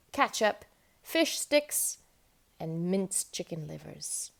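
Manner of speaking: plain reading voice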